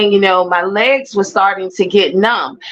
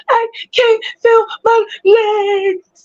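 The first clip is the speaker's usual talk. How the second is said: tearful; 12 semitones higher, slower than usual. Retold or imitated. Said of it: "I was like".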